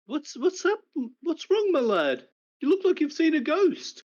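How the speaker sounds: high, concerned uncle voice; worried old uncle fussing over his lad; Uncle Carrick. Acted.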